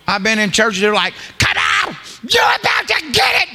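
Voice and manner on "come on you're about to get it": high-pitched imitated altar scream; mock church scream. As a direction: shriek it like an overeager altar worker. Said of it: "Don't scream at people".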